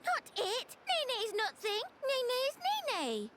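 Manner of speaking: insist, offended; indignant. Acted. Nene indignant at being thing.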